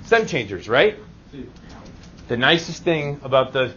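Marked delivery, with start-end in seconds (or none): none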